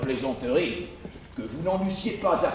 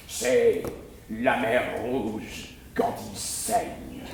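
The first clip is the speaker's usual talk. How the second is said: low, grave tragic tone; dramatic; 8 semitones lower than usual. Acted. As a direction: intone it like a tragedy. (ton dramatique et grave)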